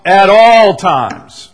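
booming slow "At ALL times"; stress on ALL. Shown as a demonstration. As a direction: punch "all" like underlining it in red. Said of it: "highlight, underline in red".

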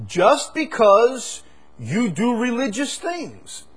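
high, loud, slow soaring proclamation; fervent sermon climax; preaching at full force. Acted. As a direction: drive it home to the congregation. Preaching crescendo.

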